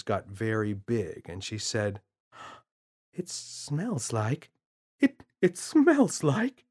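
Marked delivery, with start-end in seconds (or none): 3.1-6.6 mother realising what it is; dawning horror. Acted.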